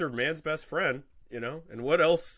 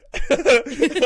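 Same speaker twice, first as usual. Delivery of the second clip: loud rising gloat laugh; 11 semitones higher, 7 dB louder than usual. Acted. Mock rich guy gloating laugh. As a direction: cackle at the losers.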